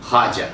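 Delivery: ख्वाजा said khaaja with no w; ख्वाजा spoken as khaaja, w silent; spoken pronunciation. Shown as a demonstration. Teacher repeats the spoken ख्वाजा: khaaja. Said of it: "लिखें ख्वाजा लेकिन बोलें".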